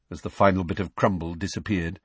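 usual speaking voice

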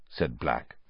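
usual speaking voice

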